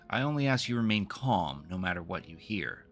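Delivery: ordinary talk, nothing marked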